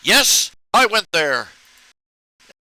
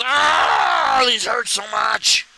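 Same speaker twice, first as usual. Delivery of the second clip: mock pained cry; 5 semitones higher, much slower than usual. Playing the cartoon girl whose goggle lenses hurt, wailing. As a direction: wail like the eyes are stinging.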